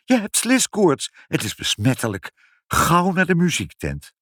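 high, urgent warning; alarmed shriek. A narrator's reading. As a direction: cry out in alarm, urging haste. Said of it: "gilde moeder".

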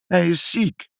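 put-on high, wailing child's voice; tearful child. A narrator's reading. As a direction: answer through pretend tears. Ibbeltje answers while crying. (huilerig, met hoog stemmetje)

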